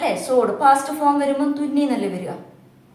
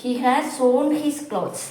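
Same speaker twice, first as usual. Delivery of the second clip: slow example reading; much slower than usual. Shown as a demonstration. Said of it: "ഇത് മൂന്നാമത്തെ ഫോമാണ്".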